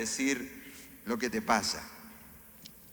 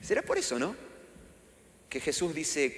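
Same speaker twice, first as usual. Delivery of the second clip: ordinary delivery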